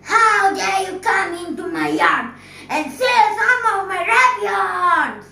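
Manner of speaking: high, loud furious witch scream. A narrator's reading. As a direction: shriek it at the thief. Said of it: "screamed at him".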